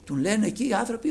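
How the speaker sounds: ordinary talk, nothing marked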